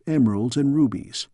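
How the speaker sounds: plain reading voice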